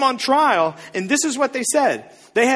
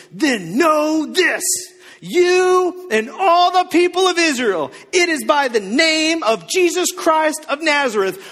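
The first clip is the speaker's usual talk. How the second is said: Stone Cold Steve Austin; 2 semitones higher, slower than usual. Retold or imitated. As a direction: declare it like a wrestler calling out rivals. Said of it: "goes completely Stone Cold Steve Austin on these fools".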